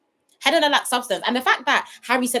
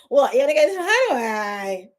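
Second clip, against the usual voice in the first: Helena; 3 dB louder than usual. Acted. Reviewer showing viewers how Helena talks, mocking impression. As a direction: lightly mimic her, on the edge of laughter. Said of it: "she's like this".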